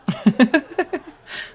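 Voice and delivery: a quick high laugh. Acted. Firefighter laughing at a thirty-minute drive, high laugh.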